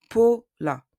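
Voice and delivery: "Paula" as POH-la, punched loud and high. Shown as a demonstration. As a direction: stress a pure O in the first syllable of "Paula". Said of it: "the sound O is produced".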